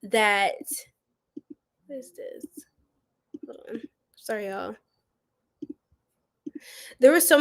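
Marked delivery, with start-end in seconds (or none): 1.9-3.8 startled unvoiced whisper to herself; hushed aside, off mic. Real, not acted. Streamer muttering to herself in a whisper. 4.1-4.8 soft unvoiced sorry; whispered apology. Real, not acted. Streamer whispering sorry to her viewers.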